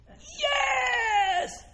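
very high, long drawn-out "yes"; strained squeal. Acted. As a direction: squeeze it out as a high cry. Joking about getting hoarse in heaven.

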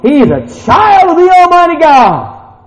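high, loud, drawn-out proclamation; exultant sermon climax; triumphant preaching. Real, not acted. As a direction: declare it at full force.

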